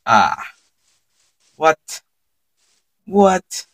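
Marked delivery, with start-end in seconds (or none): none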